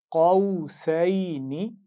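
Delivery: drawn-out, melodic tajweed recitation; slow Quran recitation. Shown as a demonstration. Teacher reciting slowly for pupils to repeat. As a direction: chant each syllable slowly for the class to copy. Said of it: "गौर से सुनिए और दोहराइए".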